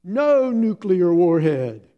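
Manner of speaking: put-on a tired little girl digging in her heels; defiant toddler. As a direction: toddler flatly refusing the game. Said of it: "she goes".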